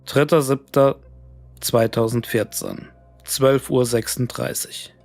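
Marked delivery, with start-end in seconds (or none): none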